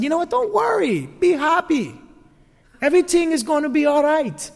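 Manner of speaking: high, sing-song "don't worry, be happy" voice; breezy cheerful reassurance. Pretend. Pastor mock-cheering the congregation, airy and high. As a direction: chirp it like a carefree pep talk. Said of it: "if I said".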